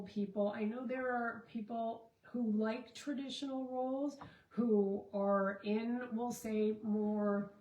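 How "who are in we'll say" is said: normal talking voice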